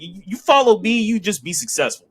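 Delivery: high-pitched self-help guru pitch; mock motivational speaker. Host mocking Finn's promo as motivational speaker. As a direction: sell it like a public speaker. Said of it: "Public speaker. It's very, um, Tim Robbins or, or Tony Robbins".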